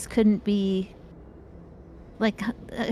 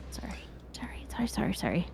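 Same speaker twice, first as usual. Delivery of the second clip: whispered sorry; 9 dB quieter, faster than usual. Real, not acted. Apologising for spoilers.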